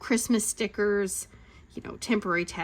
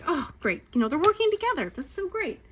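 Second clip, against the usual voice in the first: high, pleased parent; retold delight; 8 semitones higher than usual. Mom reacting to kids working together, retold delight. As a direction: coo over the kids, pitched up.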